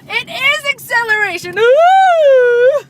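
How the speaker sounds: high, loud correct-answer call; excited announcer. Acted. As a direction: cheer like a host.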